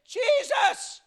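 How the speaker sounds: high, held-back imitated shout; crying out. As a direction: call out high to someone passing by. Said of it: "He cried out again".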